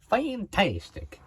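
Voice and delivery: loud, high, stretched Texas twang; Texas accent. Pretend. Vlogger showing viewers his put-on Texas accent. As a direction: draw it out big like a Texan. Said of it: "I just use a Texas accent".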